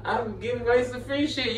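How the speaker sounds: ordinary talk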